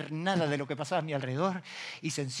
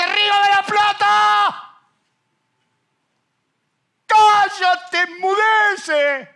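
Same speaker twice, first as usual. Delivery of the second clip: slow, much higher and louder rebuke; commanding shout; 15 dB louder, much slower than usual. Retold or imitated. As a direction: command the river to be still. Second rebuke of river. (grito de mando, lento y muy agudo)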